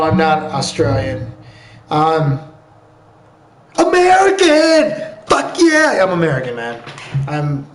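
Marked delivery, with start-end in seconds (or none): 1.9-5.2 high, drawn-out patriotic yell; patriotic shout. Acted. Mock-patriotic outburst in denial. 5.3-6.0 high-pitched hammed-up cheer; patriotic cheer. Mock-patriotic outburst continues.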